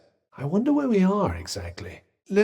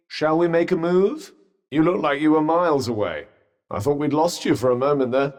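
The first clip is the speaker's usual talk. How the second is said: ordinary talk, nothing marked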